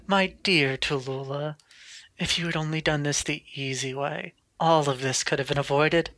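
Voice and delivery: slow, soft, silky threat; Mary the menacing captor. Acted. Mary taunting Tallulah with a katana at her throat, softly menacing. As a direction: purr the threat down at her captive.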